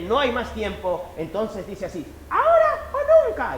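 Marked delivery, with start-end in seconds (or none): 2.3-3.6 very high, loud, slow battle cry; tiny ant charging in; little ant shouting now or never; brave tiny ant. Acted. Ant decides to bite.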